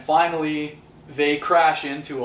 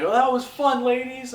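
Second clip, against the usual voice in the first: bragging villain; 9 semitones higher than usual. Retold or imitated. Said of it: "he's like".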